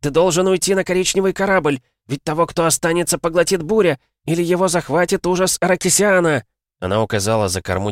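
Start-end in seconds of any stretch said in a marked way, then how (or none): 0.0-6.4 high, sustained yell over the storm; desperate raised-voice urging; urgent shouting. A narrator's reading. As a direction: press an order through the wind. Captain urging him over roaring wind.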